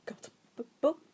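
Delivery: a timid man stumbling through; stuttering nervous. A narrator's reading. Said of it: "Despite the stuttering and faint tremble".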